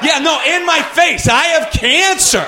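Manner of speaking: high, loud, slow punchline; shouting. Real, not acted. Comedian shouting the cancer punchline to the crowd, loud and high. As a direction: yell it out triumphant.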